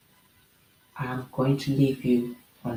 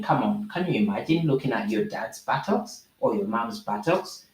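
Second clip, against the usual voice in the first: ordinary delivery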